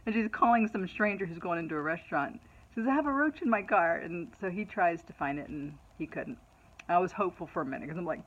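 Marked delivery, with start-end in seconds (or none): 2.9-4.0 higher, louder imitation of a worked-up daughter; retold urgent plea. Daughter telling a stranger about the roach, mock-urgent and high. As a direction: plead loudly, acting out the panic.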